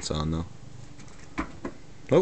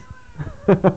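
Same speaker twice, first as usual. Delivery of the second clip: high laugh; 10 semitones higher, 4 dB louder than usual. Real, not acted. Laughing at the cat.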